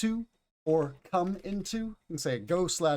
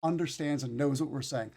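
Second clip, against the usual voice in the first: ordinary delivery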